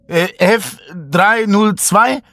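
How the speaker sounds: high, slow unsure guess; frightened. Acted. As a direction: ask it in fear. Panicked woman guessing the code.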